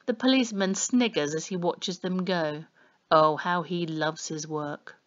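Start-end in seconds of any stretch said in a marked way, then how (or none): none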